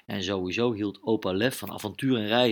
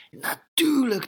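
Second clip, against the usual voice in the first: excited realisation; 14 semitones higher, 4 dB louder, slower than usual. Acted. Lucas realises Max's clever plan.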